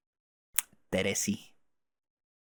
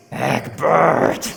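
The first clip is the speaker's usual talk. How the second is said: high, loud, rasping call; exasperated full-force yell; angry name shout; 5 semitones higher, 9 dB louder than usual. Acted. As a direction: bellow the name in fury.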